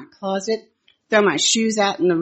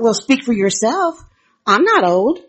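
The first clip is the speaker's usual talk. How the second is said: higher and louder, a mock indignant snap; retelling her own stung reaction, voiced up; offended retort; 5 semitones higher, 6 dB louder than usual. Woman retelling her thought after Bonnie's remark, offended and raised. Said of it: "I was very offended".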